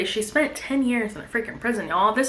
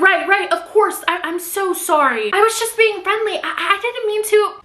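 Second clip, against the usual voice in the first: high-pitched, rushing-to-placate voice; flustered apology; 9 semitones higher, 4 dB louder than usual. Acted. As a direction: act out an embarrassed backpedal, stammering. Acted hasty apology to angry man.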